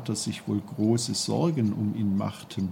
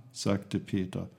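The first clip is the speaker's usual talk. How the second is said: ordinary delivery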